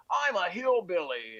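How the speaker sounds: slow, lightly Scottish Connery voice; a hint of Connery's Scottish accent, drawn out; Sean Connery impression. Comedian imagines Connery as a hillbilly, lightly Scottish. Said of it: "Sean Connery would come and go".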